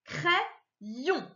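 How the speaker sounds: "crayon" split, "cray", pause, "yon"; syllable by syllable. Shown as a demonstration. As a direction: break "crayon" into two syllables. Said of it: "dans ma deuxième syllabe". (« cray… yon », syllabes détachées)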